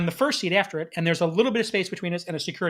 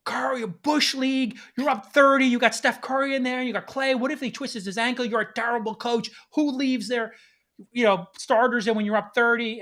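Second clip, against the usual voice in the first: high, heckling shout at the coach; heckling yell; 6 semitones higher than usual. Retold or imitated. Fan heckling the coach from baseline seats, shouting high. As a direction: jeer at the coach from courtside. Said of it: "I start yelling at Steve Kerr".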